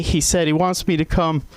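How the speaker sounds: ordinary talk, nothing marked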